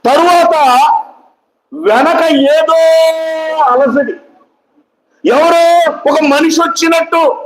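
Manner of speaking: high-pitched sermon storytelling. Acted. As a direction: preach the scene out in a high, carrying voice. Preacher retells the story at full pitch. (ఎత్తైన స్వరంతో ప్రసంగం)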